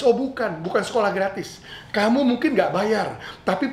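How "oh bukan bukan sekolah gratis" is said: ordinary talk, nothing marked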